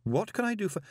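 high-pitched, harried reply; desperate. A narrator's reading. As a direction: start high, as if desperate to placate her. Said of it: "he said desperately".

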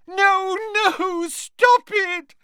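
very high, loud panicked squeal; piercing ghostly shriek of alarm; squealing Moaning Myrtle. A narrator's reading. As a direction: squeal it at the top of your range. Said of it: "squealed Moaning Myrtle".

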